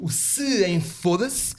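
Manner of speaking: slow, stretched syllables; drawn out slowly. Retold or imitated. Mocking the cyclist's half-said curse, slow and stretched. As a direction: spell out the curse bit by bit. Retelling the guy's unfinished curse.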